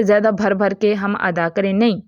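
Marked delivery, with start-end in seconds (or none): none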